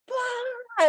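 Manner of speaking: high drawn-out "blah" wail; mock chaos outburst. Mom imitating kids erupting as she walks in, mock frenzy. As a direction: let out a high, comic burst of mayhem.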